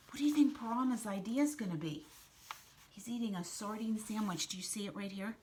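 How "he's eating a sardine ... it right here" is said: usual speaking voice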